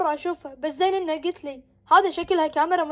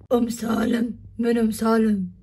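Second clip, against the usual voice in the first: menacing mother; 6 semitones lower, slower than usual. Acted. Possessed wife answers husband.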